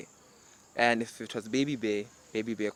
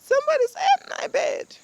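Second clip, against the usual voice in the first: very high, loud cub squeal; Baby Bear; 11 dB louder than usual. Acted. Baby bear finds Goldilocks in his bed. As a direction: shriek it like an alarmed little cub. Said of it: "Baby Bear goes".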